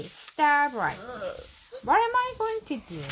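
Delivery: plain reading voice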